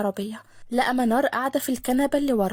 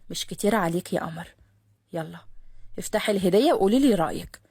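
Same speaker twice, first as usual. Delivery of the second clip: put-on a lightly lower calm young man; lower, easy-going cousin; Khaled voiced; 5 semitones lower than usual. Khaled answers Manar. (خالد بصوت أوطى هادئ)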